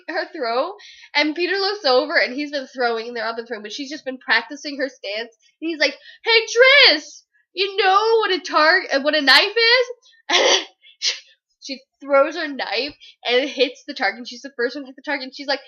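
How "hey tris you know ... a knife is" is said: higher, drawn-up taunting question; acted teasing challenge. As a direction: needle a rival with a mock-friendly question. Peter taunting Tris in retold scene.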